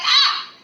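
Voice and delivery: high-pitched pelted yelp; hammed-up squeal of being hit; imitated high cry of alarm; mock scream. Girl pelted in a retold meme.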